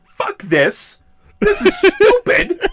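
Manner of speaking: high, drawn-out mock protest; irritated outburst. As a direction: whine loudly like an outraged moviegoer, half laughing. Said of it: "I imagine him irritated in two thousand one going".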